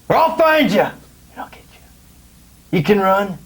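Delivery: menacing tough-cop promo; wrestling promo. Pretend. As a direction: snarl threats at the camera. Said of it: "What's the best promo you can cut right now".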